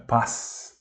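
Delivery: "Paz" ending in a voiceless s; devoiced final Z. Shown as a demonstration. Teacher demonstrates the duller ending, "Paz" with final s. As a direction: say "Paz" with a hissed s, no buzz. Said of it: "mais baixo, né".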